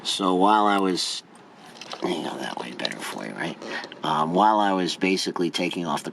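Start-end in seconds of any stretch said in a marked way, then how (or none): 2.0-3.6 whispering. Real, not acted. Aside while working on car.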